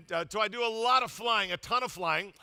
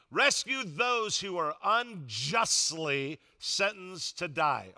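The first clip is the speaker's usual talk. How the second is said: slow reading; 2 semitones lower, much slower than usual. Shown as a demonstration. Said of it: "reads scripture slowly".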